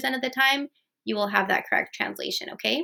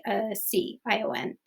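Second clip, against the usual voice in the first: ordinary delivery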